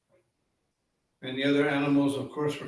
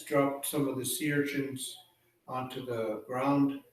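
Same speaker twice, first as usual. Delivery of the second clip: ordinary delivery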